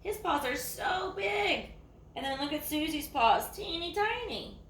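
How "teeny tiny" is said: ordinary delivery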